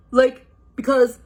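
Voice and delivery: hesitant, unsure question; timid Shaggy. Acted. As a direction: mumble the excuse. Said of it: "Shaggy said timidly".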